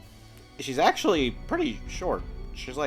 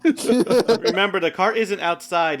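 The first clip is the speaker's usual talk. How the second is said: loud reminder; 6 semitones higher, 9 dB louder, slower than usual. Real, not acted. GM loudly reminds players.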